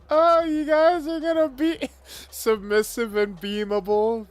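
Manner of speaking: high, whimpering mock pleas, half-laughing; hammed-up pained moaning. Gamer pinned down by opponents, hammed-up high whimpering pleas. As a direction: ham up being overwhelmed, begging for mercy. Getting beaten in game, hammed-up moans.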